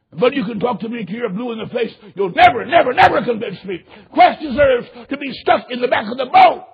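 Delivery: impassioned, hammering sermon delivery; full-force preaching. Real, not acted. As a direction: thunder it at the congregation.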